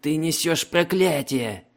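high, raging outburst. Acted. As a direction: rage at him. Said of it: "неистовствовал пленный военачальник".

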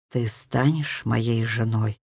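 low, slow and tender; tender proposal. A narrator's reading. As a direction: ask it softly and slowly. Предложение руки. (нежно и медленно)

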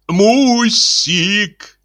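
put-on high, drawn-out toddler call; sing-song call in a toddler's voice; playful toddler. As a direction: whine it out long like a naughty little child. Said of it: "голосом шаловливого карапуза протянул".

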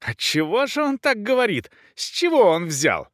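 high-pitched indignant demand; fuming countess, high without shouting; enraged. A narrator's reading. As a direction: demand an answer, voice climbing high. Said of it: "Разъярённая графиня не остывала".

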